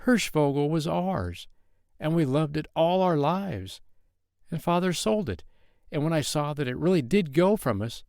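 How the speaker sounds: put-on high earnest boy's voice, begging; a little boy pouring out his plea; boy begging the king to let him stay; pleading child. A narrator's reading.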